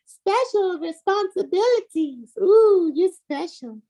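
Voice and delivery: high, drawn-out mock awe; sarcastic high-pitched fawning. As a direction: tease in a high sing-song voice. Mocking the attorney's special responsibilities.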